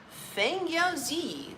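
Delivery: Feng Yao Zi read slowly and high, syllable by syllable; careful name reading. Real, not acted. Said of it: "Did I say that right?".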